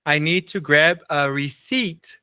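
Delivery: "receipt" said "risit" with no p sound; slow "I need to grab a receipt", dropping the p; silent p in receipt. Shown as a demonstration. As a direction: demo read, "receipt" without the p. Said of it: "Lembra que aqui não tem o T".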